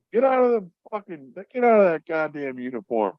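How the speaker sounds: high, barking sergeant voice; imitated drill sergeant ordering him out of uniform. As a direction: bark it like an order to a private.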